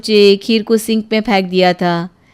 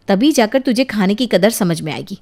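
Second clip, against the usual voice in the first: ordinary talk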